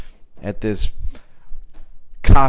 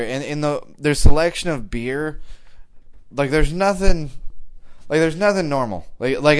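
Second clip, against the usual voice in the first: normal talking voice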